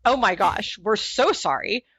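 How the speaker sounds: high, loud gushing apology; apologetic clerk. Retold or imitated. Retelling the flustered clerk's apology, high and loud. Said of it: "They go".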